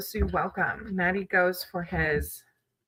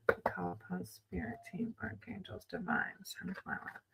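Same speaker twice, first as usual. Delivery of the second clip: hushed whisper calling on spirit; whispered invocation; 15 dB quieter than usual. Acted. Reader calls on spirit for Sunflower's reading, whispered. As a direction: breathe the names of the guides in a whisper.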